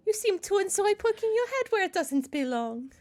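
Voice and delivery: high, hammed-up German accent, held all the way; mock German accent. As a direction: deliver the cat's line in a silly German accent. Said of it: "I'm offending the Germans".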